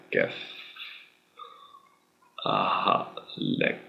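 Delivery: "Kef halek" dropped low and soft; hushed, low "Kef halek"; low murmur. Shown as a demonstration. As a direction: mutter it low under the breath. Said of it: "It's more like".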